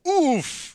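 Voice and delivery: high, loud punched oof; winded cartoon grunt; mock oof. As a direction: grunt like a kick to the stomach. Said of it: "And he goes".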